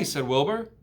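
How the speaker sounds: usual speaking voice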